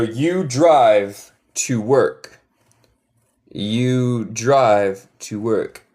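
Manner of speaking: very slow, each word drawn out; slow model sentence. Shown as a demonstration. Teacher models English sentence.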